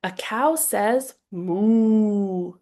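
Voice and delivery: slow, drawn-out silly moo; stretched, playful cow sound. Shown as a demonstration. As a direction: moo it out like a goofy cow for a toddler. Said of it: "so making that cow sound, very, very silly".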